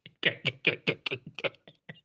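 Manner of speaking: silent wheezing laugh. Real, not acted. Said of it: "eu não consigo parar de rir".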